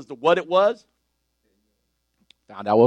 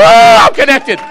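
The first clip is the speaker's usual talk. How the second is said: higher and louder, fired-up sermon; full-force preaching; 10 semitones higher, 10 dB louder than usual. Acted. Preacher lifting the church, fired-up preaching. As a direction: preach it out with rising fire.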